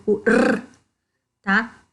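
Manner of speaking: ordinary delivery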